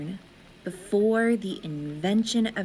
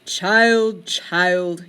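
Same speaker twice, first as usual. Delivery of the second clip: sighing father; 3 semitones lower, 5 dB louder than usual. A narrator's reading. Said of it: "your father sighs".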